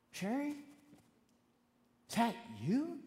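high, tentative question rising at the end; astonished disbelief. Retold or imitated. Man answering the voice in his head, incredulous. Stunned reaction to dead loved one's voice.

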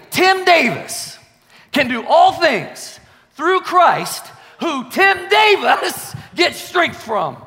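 slow, high, shouted proclamation; triumphant personal declaration. Shown as a demonstration. Preacher putting his own name into scripture, triumphant shouted declaration. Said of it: "So you know what I do? I say".

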